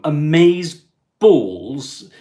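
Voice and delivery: slow, loud, drawn-out amaze balls; a hint of camp gushing. Asking viewers to subscribe, mock camp gush. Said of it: "That sounds really wrong, doesn't it?".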